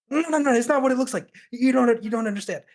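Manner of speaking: high, loud, rushed protest; flustered panic. Acted. Dwarf caught in a suspicious outfit, flustered panicked protest. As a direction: blurt it out high and fast, desperate to explain.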